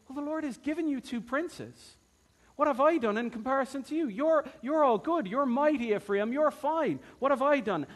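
butter them up in a high sweet voice; smooth flattery. Retold or imitated. Gideon soothing angry Ephraim with high, fast flattery. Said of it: "a man who has smooth speech".

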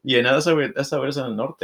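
high, fast sassy comeback; a sassy Norteño answer to his wife; playful Norteño sass. Retold or imitated. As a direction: toss it off like a proud northerner. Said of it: "And I was like".

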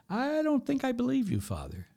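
high-pitched mock voice of a sceptic; small, high voice of the injured party; doubting victim. Injured parishioner answers Father after his apology, high doubting voice.

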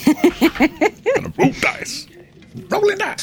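very high, slow, drawn-out sing-song; odd high singsong. Pretend. Mock-crooning at the player who spilled his dice. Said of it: "Are you feeling quite all right there, Ifan?".

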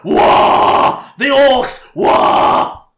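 loud, high, far orc war cry. Acted. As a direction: roar it like an orc charging. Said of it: "that's my best orc voice".

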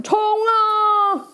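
high, loud charging yell; battle cry. Acted. Knight on a seahorse charges, loud battle cry. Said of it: "骑士骑着一只英勇的海马大喊".

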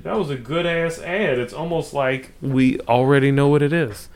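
tipsy and winding down. Real, not acted. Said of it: "I'm getting drunk".

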